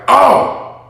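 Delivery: usual speaking voice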